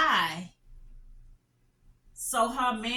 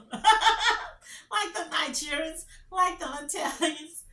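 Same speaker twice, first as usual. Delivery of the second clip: laughing; 6 semitones higher, 4 dB louder, slower than usual. Real, not acted. Laughing while explaining.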